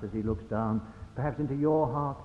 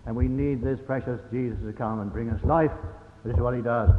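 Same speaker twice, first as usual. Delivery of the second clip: ordinary talk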